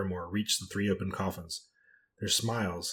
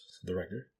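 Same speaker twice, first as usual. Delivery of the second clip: usual speaking voice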